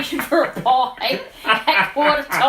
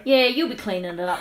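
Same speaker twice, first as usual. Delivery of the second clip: normal talking voice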